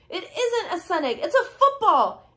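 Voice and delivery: put-on high, excited storybook boy; high-pitched glee, punching the last word; gleeful. A narrator's reading. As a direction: announce a thrilling discovery. Larch gleefully claims a football.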